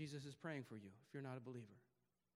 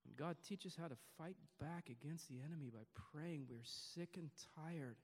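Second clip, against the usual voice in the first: prayer. Real, not acted. Closing prayer.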